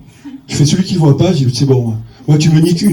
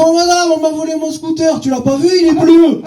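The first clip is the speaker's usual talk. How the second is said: put-on much higher, whiny Marseille accent; young Marseille guy complaining about his stolen scooter, Marseille accent; Marseille kid; 10 semitones higher than usual. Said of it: "un petit kéké marseillais".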